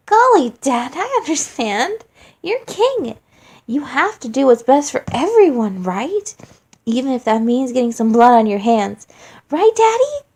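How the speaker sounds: put-on high, loud, sugary childish taunting; mock sweet taunt. Flowey taunts his weeping father with fake sweetness. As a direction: needle him in a fake-innocent sing-song voice.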